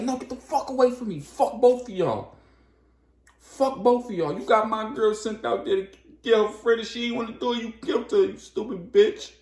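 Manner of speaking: high, loud raging scream; furious yelling. Retold or imitated. Storm screams at Star over her dead girl, high and loud. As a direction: tear into them, out of control.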